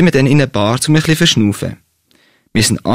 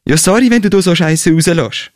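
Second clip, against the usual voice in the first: higher-pitched annoyed retort; mildly acted angry shout; yelling back; 6 semitones higher than usual. A narrator's reading. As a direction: snap back in a quarrel. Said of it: "schreit er sie an".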